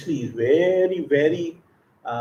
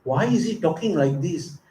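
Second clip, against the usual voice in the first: a lightly voiced hurt wife asking herself; puzzled, wounded, half to herself; hurt wondering; 2 semitones higher, 4 dB quieter than usual. Rukmini stunned by her husband's teasing, lightly hurt. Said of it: "She said".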